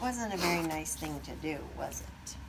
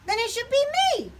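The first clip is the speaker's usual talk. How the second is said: bragging turkey; 8 dB louder, much faster than usual. A narrator's reading. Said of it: "Wing said".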